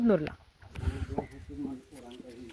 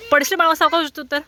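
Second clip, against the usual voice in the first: much higher and louder, urgent; mother warning her little son he'll fall, loud and high; alarmed warning; 9 semitones higher, 8 dB louder, faster than usual. Real, not acted. Warning the child not to fall.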